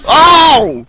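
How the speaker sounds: excited gamer shout. Real, not acted. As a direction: yell it out hyped.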